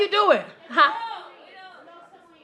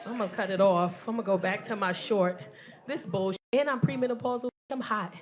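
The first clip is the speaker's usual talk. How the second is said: soft, resigned, under the breath; deflated mutter; 6 semitones lower, 9 dB quieter than usual. Real, not acted. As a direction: give up, talking low to yourself. Deflated after rant.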